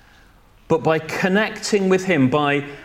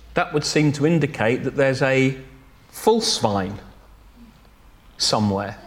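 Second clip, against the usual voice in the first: ordinary delivery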